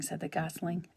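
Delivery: ordinary delivery, nothing marked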